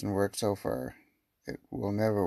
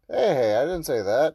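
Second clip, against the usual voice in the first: loud protest; 3 semitones higher, 7 dB louder than usual. Acted. Character protesting accusation.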